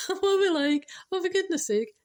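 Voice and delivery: hammed-up flustered family; amused family realising their mistake; exclaiming family. Family voiced in a retold story.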